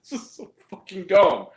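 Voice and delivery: exasperated outburst. Real, not acted. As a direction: vent at the dumb movie. Ranting about the ending.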